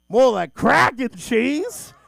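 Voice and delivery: very loud, high, drawn-out exclamation; shouted punchline. Acted. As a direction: blast out the punchline in slow, heavy chunks. Shouted punchline after quiet musing.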